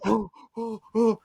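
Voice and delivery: high, startled mock gasps of fright; hammed-up scared creature yelps. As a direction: play the frightened Na'vi gasping. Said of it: "and they're, like, scared".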